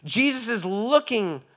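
loud, high preaching that keeps climbing; full-force preaching. Acted.

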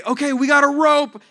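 high, loud rescuer's call. Acted. Rescuers to man in a well, loud high call. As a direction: shout help down to someone far below. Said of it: "And then they say".

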